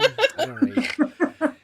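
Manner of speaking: high, loud, slow dopey voice; dim Sancho voice. Retold or imitated. Reviewer mocking Sancho's dopey line. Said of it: "I'm like".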